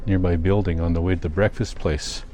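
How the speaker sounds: normal talking voice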